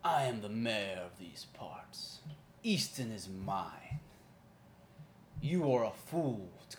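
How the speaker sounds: slow menacing villain speech; booming echoing villain monologue. Acted. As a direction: gloat like a tyrant mayor. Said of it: "He goes".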